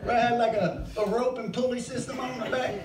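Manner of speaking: ordinary talk, nothing marked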